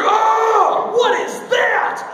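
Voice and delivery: high, loud mock outcry; shocked yelp. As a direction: yelp as if jabbed by a needle. Shocked by needle in leg.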